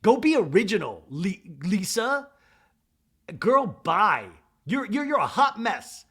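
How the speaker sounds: sassy mock shout; Mary telling Lisa off, hammed-up shout; mock yelling Mary. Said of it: "And Mary goes".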